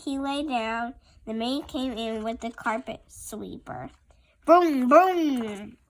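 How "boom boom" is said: loud thumping boom boom. Acted. Child reads the sweeper noise loudly. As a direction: bang it out.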